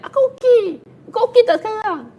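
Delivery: hammed-up irritated shout; mock angry retort. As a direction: bite back in an acted quarrel. Acted quarrel, blue person.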